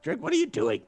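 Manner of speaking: high, loud yell at Drake; a small gnome shouting at a friend. Acted. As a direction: yell at him in disbelief. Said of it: "the gnome kinda yells at him".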